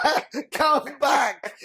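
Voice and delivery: high-pitched pleading call; calling after someone. Retold or imitated. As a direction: call her back, comically high. Retold calling after fleeing girl.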